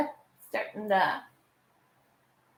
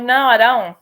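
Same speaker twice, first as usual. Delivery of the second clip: louder, flat-out no; loud refusal; 2 semitones higher, 10 dB louder than usual. Retold or imitated. Refusing her sister, loud and firm. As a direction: shout the refusal back. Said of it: "I'm like".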